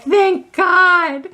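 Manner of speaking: high, loud wail of relief; hammed-up crying thanks, retold; tearful relief. Storyteller reenacts crying after date calls it off, tearful relief. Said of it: "I started crying".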